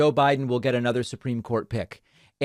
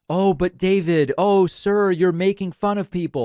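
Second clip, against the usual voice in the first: mock whiny objection; 6 semitones higher, 4 dB louder than usual. Mocking his critics' objection.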